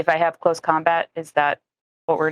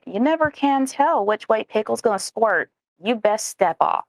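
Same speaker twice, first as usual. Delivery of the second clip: commanding, higher-pitched intimidation; stern warning; 5 semitones higher than usual. Acted. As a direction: give a stern in-character warning to back off. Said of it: "my sternest look and say".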